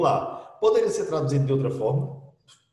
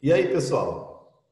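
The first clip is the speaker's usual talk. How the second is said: slow demo greeting; 3 dB louder, much slower than usual. Shown as a demonstration. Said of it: "se eu digo assim".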